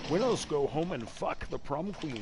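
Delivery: attempted Scottish movie-star accent; Sean Connery. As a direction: quote it in a rough Scottish burr. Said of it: "Supposed to be, uh, Sean Connery in The Rock".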